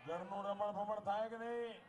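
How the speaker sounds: loud, high rally speech to the crowd; rally oratory. Real, not acted. Politician rousing a rally crowd, loud and high. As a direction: preach it at full force to the crowd.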